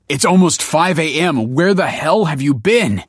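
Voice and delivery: high, loud outraged demand; angry yell. A narrator's reading. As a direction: yell at someone who is very late. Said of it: "he yelled".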